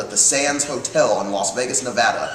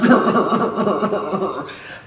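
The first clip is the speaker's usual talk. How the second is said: higher, rattling imitated chuckle; Betty; 5 semitones higher than usual. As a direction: do the godmother's long stuttering laugh. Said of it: "She laughed like this".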